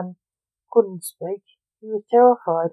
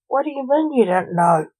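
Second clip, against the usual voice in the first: father snaps in disbelief, higher; snapping father; 5 semitones higher than usual. Acted. Father snaps at his son's answer, higher. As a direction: bite back at the boy. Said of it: "snapped Blake".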